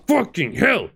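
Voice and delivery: real gamer frustration yelp; frustrated outburst. Real, not acted. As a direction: blurt it out, fed up. Repeats the curse, then louder.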